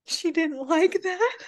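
high, slow sing-song aside; high comic voice. Retold or imitated. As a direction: stretch it out high and amused. Comic aside about Dowager.

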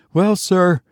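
high, sheepish servant voice; apologetic. A narrator's reading. Servant about to explain himself to Tussman, high and apologetic. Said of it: "the man laughed apologetically".

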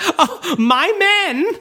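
swooning woman. Retold or imitated. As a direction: gush over the men. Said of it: "she's like".

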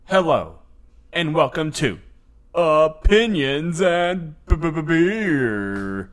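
slow stretched host opener; show host intro. Acted. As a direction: drag the show title out like an announcer. Show intro with stuttered title.

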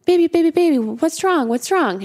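urgent, high retold reassurance; concerned father. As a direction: imitate a dad anxiously soothing his child. Father answering his crying daughter.

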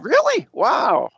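shocked, very high "Really?"; surprised. Real, not acted. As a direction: blurt it out in disbelief. Surprised at the four-hour distance.

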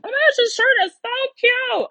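high-pitched squeal of delight; gushing delight. Retold or imitated. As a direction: swoon over a cute shirt. Imagined shopper loving a shirt.

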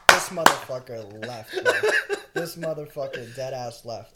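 slow, loud, laughing "This motherfucker left"; laughing disbelief. Retold or imitated. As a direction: laugh through the words in disbelief.

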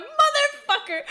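high, squeaky mock Kermit curse; Kermit voice attempt. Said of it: "I'm like".

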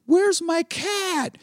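slow, high-pitched mock whine; stoned girlfriend imitation. Stoned girlfriend voiced in retelling.

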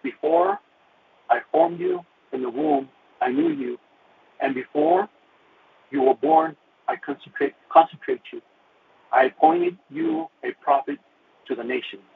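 solemn scripture read, slowed and lifted; scripture recital. Retold or imitated. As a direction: declaim the verse like a preacher. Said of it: "it says".

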